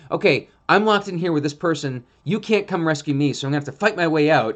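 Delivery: a trapped dog deciding to fight; desperate dog voice. Retold or imitated. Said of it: "they're just like".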